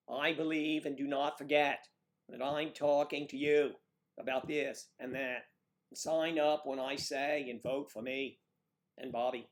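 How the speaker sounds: orate like a Kennedy on the stump; booming mock Kennedy rally speech; JFK impression. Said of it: "You sound like J F K".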